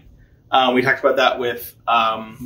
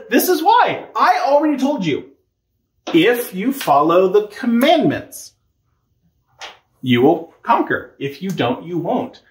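exasperated God; 6 semitones higher than usual. Acted. God answering Joshua, exasperated.

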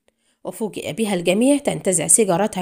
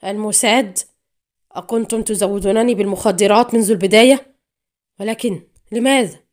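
alarmed questions, swelling louder; dismayed; 6 dB louder than usual. A narrator's reading. Addict learning who supplied his drugs, dismayed. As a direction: ask it in growing dismay. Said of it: "خرج صوت حافظ جزعا".

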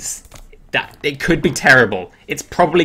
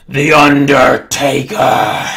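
loud, drawn-out put-on voice; dramatic wrestler announcement; 6 dB louder, much slower than usual. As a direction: announce the wrestler slowly and loudly, stretching every syllable. Dramatic reveal of wrestler's card.

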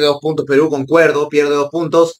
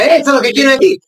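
excited cheering; 9 semitones higher, faster than usual. Real, not acted. Cheering on a player.

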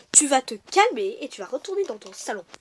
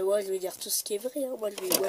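ordinary delivery

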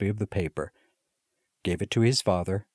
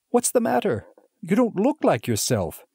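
put-on much higher, young boys just woken; schoolboys' voice; 12 semitones higher, 3 dB louder than usual. A narrator's reading. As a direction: voice the little brothers. Schoolboys wake and ask.

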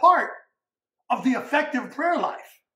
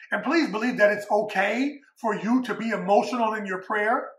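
normal talking voice

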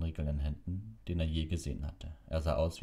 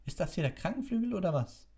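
high-pitched, irate matron; 14 semitones higher than usual. A narrator's reading. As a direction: demand it in outraged disbelief. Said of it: "sagte Madame Pomfrey erzürnt". (erzürnt, mit hoher Stimme)

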